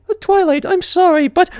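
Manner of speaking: high, prim apologetic pony; Rarity letting Twilight down; fancy pony apology. A narrator's reading. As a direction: apologize sweetly.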